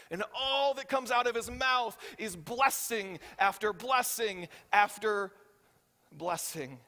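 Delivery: slowing emphasis on blessing after blessing; building refrain. Real, not acted. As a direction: land each blessing heavier.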